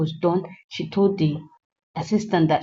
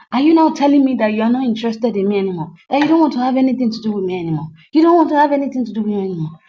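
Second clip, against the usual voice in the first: rattled off fast and a bit louder, upset; rapid hurt protest; 3 semitones higher, 3 dB louder, much faster than usual. Retold or imitated. Partner hurt by her rejection, fast upset questions. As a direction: fire the questions off in one hurt rush.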